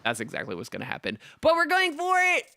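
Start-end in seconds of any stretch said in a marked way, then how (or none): 1.4-2.4 excited shout. Real, not acted. Committing to a risky roll.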